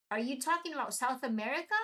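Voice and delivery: ordinary talk, nothing marked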